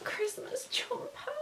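high, soft, slow whisper; awed whisper. Real, not acted. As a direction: breathe the words in wonder. Moved by the gift.

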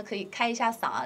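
ordinary delivery

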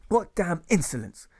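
high, loud bully's yell; bullying yell. Acted. Teacher yelling at the class like a bully. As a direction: bellow it like a bully. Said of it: "he yells like a bully".

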